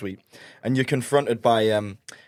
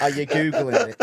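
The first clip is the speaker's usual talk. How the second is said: mocking robot. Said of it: "he kept mocking me".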